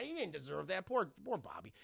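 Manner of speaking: ordinary delivery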